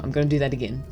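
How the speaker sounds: normal talking voice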